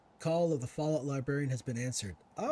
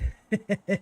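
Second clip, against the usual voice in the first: burst of laughter; 6 semitones higher, 7 dB louder than usual. Real, not acted. NPC line in southern accent.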